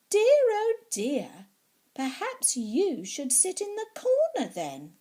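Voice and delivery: a fussy teacher tutting, then scolding; voicing the ballet teacher. Ballet teacher voiced in story.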